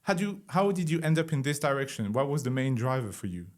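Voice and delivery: usual speaking voice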